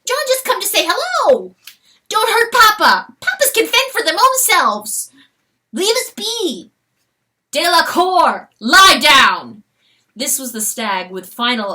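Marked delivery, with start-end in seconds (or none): 0.0-1.5 high loud weasel protest. Acted. Weasel fleeing the fawn, high protest. 2.1-6.7 put-on high loud child's plea; cried. Fawn defending her father, high cry. 7.5-9.6 loud authoritative order; stern command. Acted. Stag ordering his fawn down, loud command.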